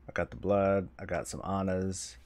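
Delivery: normal talking voice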